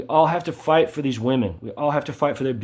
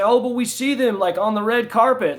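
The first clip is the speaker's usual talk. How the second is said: much higher, mocking a lazy argument; 9 semitones higher than usual. Mocking people who cite the red carpet, high and sneering. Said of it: "they say".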